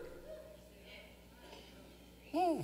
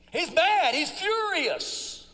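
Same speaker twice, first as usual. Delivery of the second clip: higher, stretched out slowly; emphatic preaching; 6 semitones higher, slower than usual. Real, not acted. Preacher telling the congregation how furious Jonah was. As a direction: drive the anger home.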